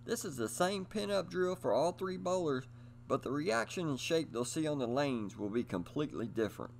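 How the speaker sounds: ordinary talk, nothing marked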